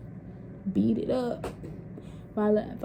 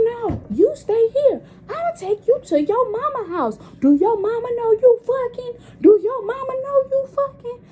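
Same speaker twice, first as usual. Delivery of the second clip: much higher, louder scolding auntie; threatening auntie; 14 semitones higher, 5 dB louder than usual. Retold or imitated. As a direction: threaten to tell her mama. Auntie threatens to tell mama.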